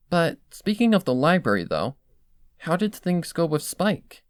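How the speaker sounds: anxious. Acted. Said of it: "turns anxious with my next words".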